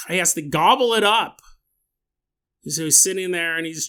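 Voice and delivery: usual speaking voice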